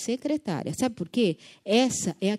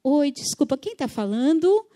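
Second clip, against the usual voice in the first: bright, friendly, high and a bit louder; sweet phone voice; 6 semitones higher, 5 dB louder than usual. Shown as a demonstration. Caller to receptionist on the phone, sweet friendly tone. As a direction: charm the receptionist with your tone. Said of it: "o seu tom de voz tem assim".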